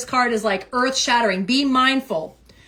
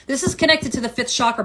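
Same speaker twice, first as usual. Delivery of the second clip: ordinary talk, nothing marked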